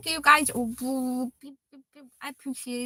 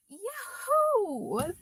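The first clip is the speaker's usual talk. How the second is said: higher, sing-song "Yahoo"; playful yahoo; 6 semitones higher than usual. Pretend. Streamer echoing a chat name in a playful yelp. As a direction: toss off a bright, pitched-up "Yahoo". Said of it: "playful repeat, higher".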